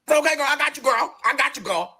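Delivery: high, quick assurance; eager reassurance. Retold or imitated. New server answering Madison, high eager reassurance. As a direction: promise her eagerly.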